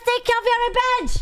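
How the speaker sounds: high, fast mocking girly voice; teasing high-pitched imitation of a chatter; mock Becky voice. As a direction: squeak it out like a silly Becky. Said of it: "the Becky says".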